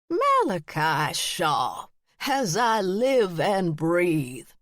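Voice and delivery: put-on slow, crotchety old woman; drawn-out crotchety greeting; crotchety old aunt. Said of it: "the crotchety voice".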